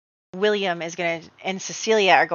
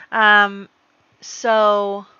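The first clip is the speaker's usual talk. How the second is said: ordinary talk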